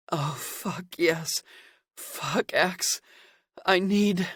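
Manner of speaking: high, breathy whimpering moans. A narrator's reading. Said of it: "he whimpered".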